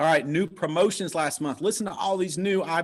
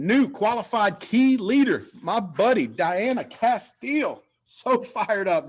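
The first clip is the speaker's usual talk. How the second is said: excited announcement; 5 semitones higher, 4 dB louder, slower than usual. Real, not acted. Announcing a key leader promotion.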